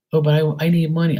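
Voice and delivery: pleading beggar. Acted. Acted partner begging for money.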